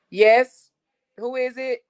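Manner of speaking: loud high call toward the door. Real, not acted. Calling out to someone knocking at the door, loud and high. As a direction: shout it across the house to whoever knocked.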